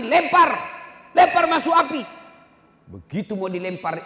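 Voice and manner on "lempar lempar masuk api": loud, high order; commanding shout. Retold or imitated. The Prophet orders the son thrown into the fire, shouting. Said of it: "Kata Nabi".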